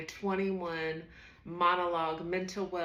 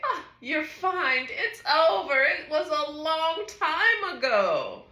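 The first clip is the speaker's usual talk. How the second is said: loud, higher, breezy brush-off; dismissive inner voice; 10 semitones higher, 8 dB louder than usual. Acted. Mind telling the speaker it is long over, loud and dismissive. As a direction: wave the worry away loudly, stretching the last words. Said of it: "my mind would say".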